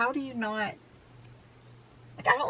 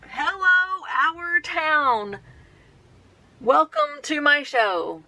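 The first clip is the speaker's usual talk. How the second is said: high, loud, slow showman announcement; karaoke host; 7 semitones higher, 6 dB louder, much slower than usual. Retold or imitated. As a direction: belt it like a party emcee. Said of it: "got on her karaoke machine and said".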